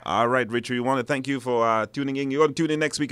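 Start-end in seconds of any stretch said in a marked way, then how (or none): none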